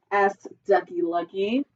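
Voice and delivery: usual speaking voice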